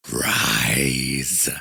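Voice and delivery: very deep, slow, distorted giant command; one vast rumbling word from a titan; earth-shaking god voice. A narrator's reading. The Overmind commands the kneeling abomination, booming very deep. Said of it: "Its voice shook the earth".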